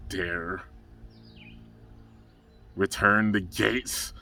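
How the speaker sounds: a hammed-up cartoon villain's menace; menacing villain. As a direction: growl the threat slowly. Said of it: "He's like".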